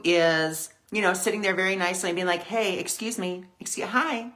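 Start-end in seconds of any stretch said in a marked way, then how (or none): 2.4-4.3 demure friend. Acted. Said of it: "a little more demure".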